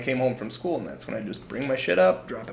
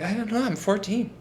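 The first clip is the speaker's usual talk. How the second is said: put-on scared kid; 9 semitones higher than usual. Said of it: "I was like".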